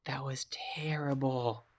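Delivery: slow, dismayed whisper. Real, not acted. Player reacting to his bad throw, slow whisper. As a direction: breathe it out in disbelief.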